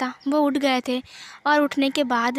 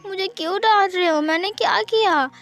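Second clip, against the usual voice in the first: hurt whimper; 5 semitones higher than usual. Acted. Wing hurt, tears in his eyes.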